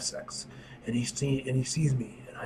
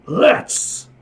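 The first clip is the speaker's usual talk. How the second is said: battle cry; 10 semitones higher, 11 dB louder than usual. Acted. Character accepts the fight.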